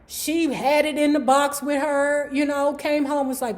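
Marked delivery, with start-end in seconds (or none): none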